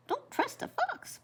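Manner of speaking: very high squeaky quoted warning; grandma voicing the hare quoting his mother; mother's warning. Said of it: "Mom always said".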